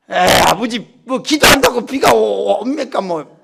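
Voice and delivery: loud, drawn-out doubtful protest; a boy's loud disbelieving reply; sceptical son. Retold or imitated. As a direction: brush off your father's idea. Son doubting father's plan.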